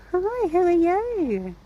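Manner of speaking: high, loud, slow sing-song hello; excited pet-talk voice; gushing greeting. Real, not acted. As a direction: coo drawn-out delight at a horse. Greeting a horse.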